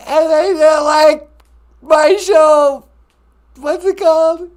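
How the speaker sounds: high, loud, hammed-up crying wail; mock Jussie Smollett. Prank caller sobbing to the radio host, high mock wail. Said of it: "Jussie Smollett is on the telephone".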